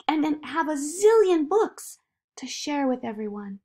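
lightly voiced girl, measured then livelier; Zoe, hopeful child. Zoe telling the voice she'll learn to write, hopeful.

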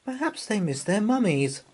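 put-on high, small child's voice; little girl Anna. A narrator's reading. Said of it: "said Anna".

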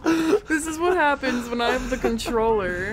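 laugh-crying through the words; half laugh, half wail. Real, not acted. Laughing after dying in game.

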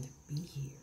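whispered. Real, not acted. Speaking of suicide gently.

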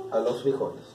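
normal talking voice

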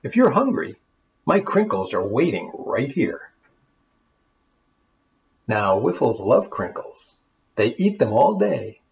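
lively rhyming storybook reading; story narration. A narrator's reading. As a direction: read it like a bedtime tale for kids. Reads the rhyming tale aloud.

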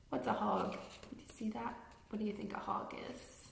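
soft whispered question. Acted. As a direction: ask it in a secret hush. Hushed questions to children.

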